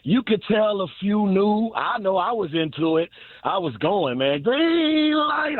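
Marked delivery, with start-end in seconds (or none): 4.4-5.6 excited. Retold or imitated. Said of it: "I was going nutballs".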